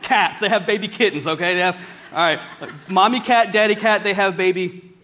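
usual speaking voice

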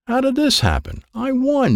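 high-pitched outcry without much volume; indignant yell. Acted. Dad on hearing he did not win, yelling. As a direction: protest in high disbelief. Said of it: "Dad yelled".

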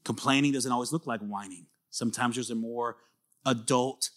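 quiet reflection prompt. Acted. Asks them to close their eyes.

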